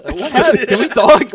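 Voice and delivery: high, loud hammed-up concern; mock concern. Friend acting out reply to being slapped, mock concern.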